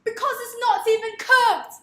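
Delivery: loud, high British chef rant; Gordon Ramsay. Acted. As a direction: yell it slowly, building in outrage, in British. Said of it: "that was Gordon Ramsay".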